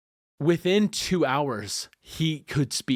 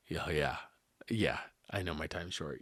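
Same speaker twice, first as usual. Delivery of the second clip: weak and quiet; 9 semitones lower, 12 dB quieter than usual. Retold or imitated. Dying cousin answers weakly.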